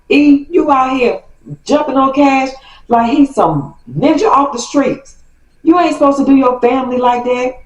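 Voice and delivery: aunt scolding on the phone; mock aunt going off. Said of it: "she just going off".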